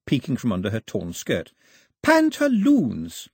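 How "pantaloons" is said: slow, high, drawn out; raised, slow baby talk; slow teaching voice. A narrator's reading. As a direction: spell it out slowly like a teacher. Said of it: "this time she pointed".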